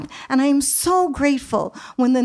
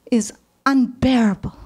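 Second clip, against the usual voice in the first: ordinary delivery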